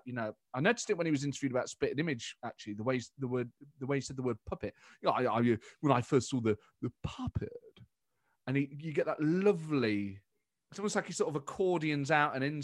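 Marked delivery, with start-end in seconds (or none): 5.0-7.5 Boris. Retold or imitated. Politician interviewed about Spitting Image, stretched-syllable impression. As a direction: stammer up to a stretched "puppet". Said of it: "he'll stretch a syllable out".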